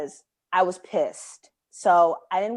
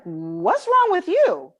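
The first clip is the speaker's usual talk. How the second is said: high, slow, stunned question; taken aback; 10 semitones higher, 4 dB louder, much slower than usual. Retold or imitated. Startled friend asking on the phone, slow disbelief. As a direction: ask it slowly, higher, in disbelief. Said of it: "Like I said, it just like that".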